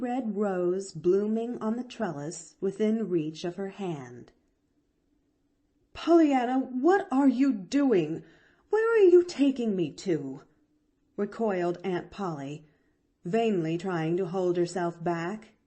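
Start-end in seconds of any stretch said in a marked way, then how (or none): 5.9-10.4 alarmed protest. A narrator's reading. Aunt Polly resisting being dragged.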